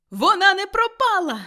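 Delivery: loud, high shout of relief; excited high-pitched exclamation; joyful cry. A narrator's reading. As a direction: cry out, overjoyed and agitated. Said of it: "закричав Скрудж".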